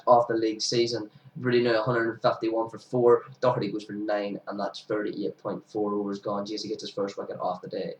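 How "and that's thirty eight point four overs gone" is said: ordinary delivery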